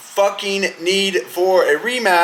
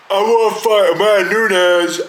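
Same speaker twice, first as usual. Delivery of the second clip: loud, higher put-on voice mocking Pena; 3 semitones higher, 4 dB louder than usual. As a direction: throw the line out like a dumb challenge. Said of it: "she goes".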